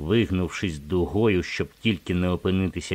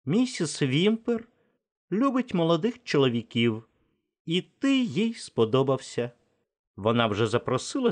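Natasha's voice; 10 semitones higher than usual. Acted. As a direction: tease him lightly, pitched up. Narrator voices Natasha, high. (високий глузливий жіночий голос)

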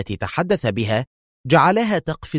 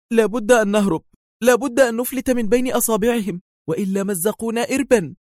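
much higher urgent frightened pleading; terrified woman; trembling fear; 12 semitones higher than usual. A narrator's reading. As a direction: beg to flee, shaking. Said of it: "ارتجفت من قمة رأسها".

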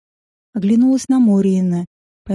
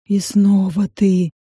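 lake serpent voice; 2 semitones lower, slower than usual. Acted. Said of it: "узнал меня хозяин Лазоревого озера".

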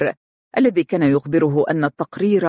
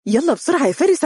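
higher, bubbling with joy; happy, excited; 10 semitones higher, faster than usual. A narrator's reading. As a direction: call him over brimming with delight. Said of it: "وهي تقول بسعادة". (زوجة تستعجل زوجها بفرح وحماس)

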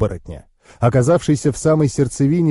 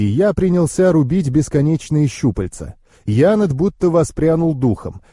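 usual speaking voice

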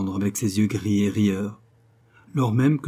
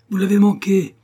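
«Vous l'avez manqué!» crié bien plus haut et plus fort, chute sur la fin; cri déçu; 10 semitones higher, 7 dB louder than usual. A narrator's reading. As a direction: criez le reproche à travers la cour. Poursuite de la poule, déception. (Nell crie sa déception à Master Cronin, beaucoup plus haut et plus fort)

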